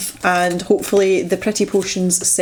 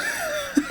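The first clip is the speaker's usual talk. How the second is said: short laugh; 9 dB quieter than usual. Real, not acted. Laughing at own mispronouncing.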